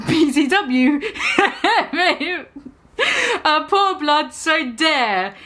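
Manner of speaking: giggling through the letters; cracking up mid-read; laughing. Real, not acted. Reader laughing while reading the author's note aloud. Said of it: "laughter breaks the reading".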